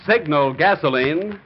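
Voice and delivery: usual speaking voice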